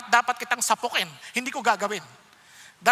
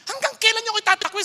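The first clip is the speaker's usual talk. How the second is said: angrier repeat; 6 semitones higher, faster than usual. Acted. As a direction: press the question harder and quicker. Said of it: "sabi ni Lord".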